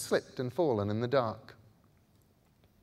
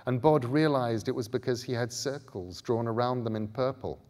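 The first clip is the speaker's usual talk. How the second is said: plain reading voice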